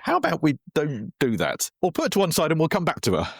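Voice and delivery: a hint of playful outrage; indignant mock protest. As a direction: pretend to object, pushing back fast. Retold protest, voice shifted high.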